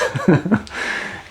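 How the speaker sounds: high airy laugh at own joke; breathy high chuckle; high breathless giggle. Real, not acted.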